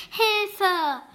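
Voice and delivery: a high, loud call for help; cry for help. A narrator's reading. The trapped witch cries out for help, high and loud. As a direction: yell for help from behind the bushes. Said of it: "einen Hilferuf".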